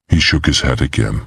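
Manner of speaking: plain reading voice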